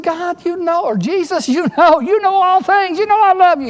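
high, insistent retort, acting put out; mock-exasperated pleading, rising in force; exasperated, getting angry. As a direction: act out a frustrated protest, pitch way up. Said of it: "He was getting angry. He was getting put out".

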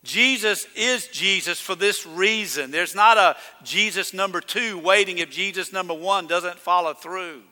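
ordinary talk, nothing marked

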